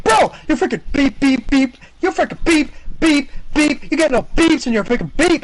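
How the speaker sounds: very high-pitched imitation of a raging player; retold gamer tirade, high and indignant; mock angry rant. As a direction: rant it high, punching each censor beep.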